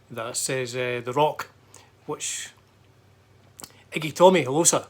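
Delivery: usual speaking voice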